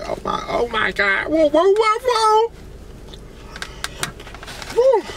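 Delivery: high rising whoa of pure delight; delighted exclaim. Real, not acted. Eating on camera, overwhelmed by the taste, high excited whoas. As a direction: squeal over how good the food is.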